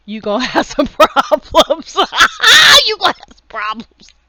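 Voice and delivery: loud warning breaking into high laughter; laughing hard. Real, not acted. Vlogger warning viewers about being different, laughing hard. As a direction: crack up loudly mid-warning.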